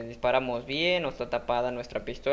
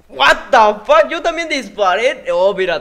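shocked outburst; 8 semitones higher, 15 dB louder, slower than usual. Real, not acted.

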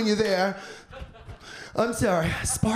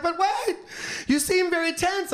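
a pushy masseuse, pitched up; high Mei Ling voice; 7 semitones higher than usual. Retold or imitated. Masseuse chasing him through the airport, high and soothing. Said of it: "She's like".

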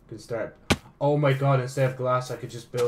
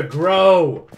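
a sudden loud laughing shout of grow; shouted command; 8 semitones higher, 16 dB louder than usual. Real, not acted. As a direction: bark the order at the sheep.

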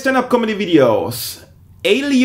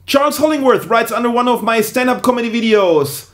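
normal talking voice

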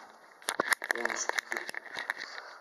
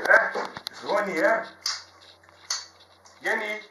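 ordinary talk

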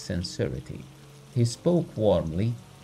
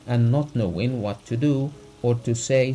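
ordinary delivery, nothing marked

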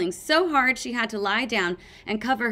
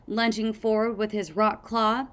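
ordinary delivery, nothing marked

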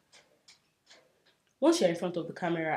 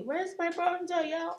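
calling out; 11 semitones higher than usual. Real, not acted. Calling out for her missing bronzer.

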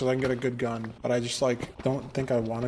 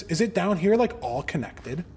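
ordinary talk, nothing marked

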